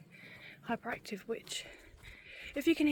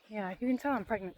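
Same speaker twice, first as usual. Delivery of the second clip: ordinary talk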